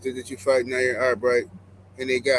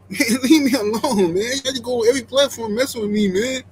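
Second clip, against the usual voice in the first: higher whiny mock of a rival boxer; mocking imitation; 5 semitones higher, 3 dB louder than usual. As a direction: mimic a rival whining to be left alone. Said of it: "both of them are saying".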